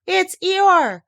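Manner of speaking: high, loud thrilled cry; terribly excited. A narrator's reading. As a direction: burst out with a little one's thrill. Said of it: "terribly excited".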